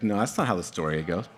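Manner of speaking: low objection with a chuckle; mildly mock protest, kept low; amused objection. Voicing listeners who doubt the story, amused and low.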